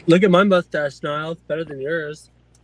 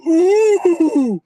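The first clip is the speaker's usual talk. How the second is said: mock angry ooh; 11 semitones higher, 5 dB louder than usual. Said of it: "I'm getting so mad".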